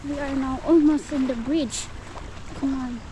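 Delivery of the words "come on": ordinary talk, nothing marked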